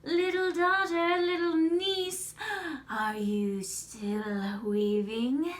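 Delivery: loud singsong call, slow and drawn out; high chanting call, then a long rising question; witch calling out. Acted. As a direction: sing it out to the next room. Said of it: "Baba Yaga called in".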